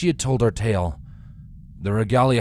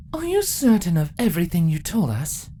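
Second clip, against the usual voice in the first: doubting queen; 9 semitones higher than usual. Acted.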